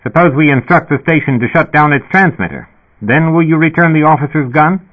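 a dispatcher crackling through the speaker; sputtering intercom voice. A narrator's reading. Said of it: "The speaker sputtered".

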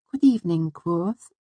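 put-on very high, light girlish voice; Auri. A narrator's reading. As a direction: greet him in a dainty, high-pitched voice. Auri greets him.

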